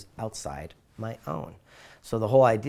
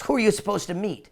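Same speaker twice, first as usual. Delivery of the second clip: high-pitched retold indignation; storyteller's upset mailman, pitched up; mock upset; 9 semitones higher, 4 dB louder than usual. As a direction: act out mild outrage on someone's behalf. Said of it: "the mailman gets upset".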